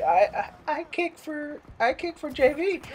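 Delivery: a hint of a timid, stammering kid; lightly voiced nervous Corey; nervous teammate. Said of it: "is like".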